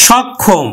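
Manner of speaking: সক্ষম as shawkkhom, open first vowel. Shown as a demonstration. Teacher showing the natural vowel, open shawkkhom. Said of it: "স্বাভাবিক উচ্চারণ হবে".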